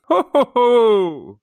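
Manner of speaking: slow, high, booming Santa ho ho ho; belly-laugh it out like Father Christmas. Pretend. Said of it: "What are your buzzers, Carl?".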